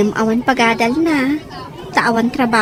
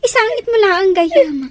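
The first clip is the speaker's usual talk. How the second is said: tearful; 7 semitones higher than usual. Acted. Consoling the grieving girl.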